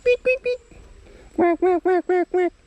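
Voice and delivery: high, loud duck quacking; bird-call imitation. Parent making bird noises for a child, high-pitched quacking.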